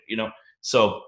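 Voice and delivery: usual speaking voice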